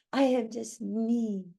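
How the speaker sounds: normal talking voice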